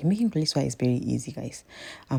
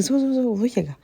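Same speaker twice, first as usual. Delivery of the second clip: retold husband calling her name up the stairs, higher and louder; 9 semitones higher, 5 dB louder than usual. Husband calls wife down to see surprise, calling out loudly. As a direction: call the name out like from the bottom of the stairs.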